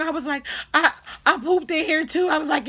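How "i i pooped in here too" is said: high, loud wailing confession; distressed confession. Retold or imitated. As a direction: act out panicked tattling on yourself.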